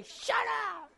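high-pitched mock yell; mock temper scream. Preacher shows what Jesus didn't say, mock screaming. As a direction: play a powerless ruler losing his temper. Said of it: "raise your temper and scream to scare people".

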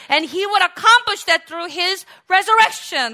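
loud, high preaching at full force; a soaring, shouted sermon climb; fiery preaching. Real, not acted. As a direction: preach it out to the whole hall.

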